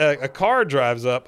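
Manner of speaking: ordinary talk, nothing marked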